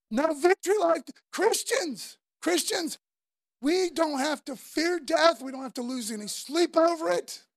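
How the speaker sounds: fiery preaching; preaching at full force. Acted. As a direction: lift the congregation, ringing.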